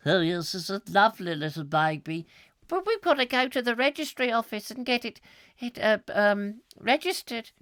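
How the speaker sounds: lightly voiced prim husband; a hint of a fussy Victorian father; Mr Stoker voice. Father admiring baby to wife, lightly voiced husband.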